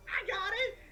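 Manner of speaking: high-pitched, loud panicked cry; freaking out. Acted. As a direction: shout it in a frantic, squealing panic. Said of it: "he's freaking out".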